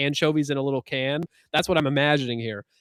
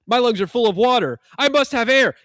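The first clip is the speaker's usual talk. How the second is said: panicked; 8 semitones higher, 8 dB louder than usual. Retold or imitated. Bob's drowning vision retold.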